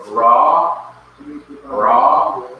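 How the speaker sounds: "ra" said loud and drawn out; "ra" with a long open vowel; pronunciation demo. Shown as a demonstration. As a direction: model the word "ra" clearly for a class. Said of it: "that's how you pronounce it".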